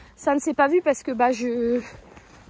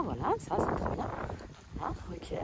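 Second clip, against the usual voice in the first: chuchoté; 5 semitones lower, 11 dB quieter than usual. Real, not acted. Chuchote pendant l'arrêt.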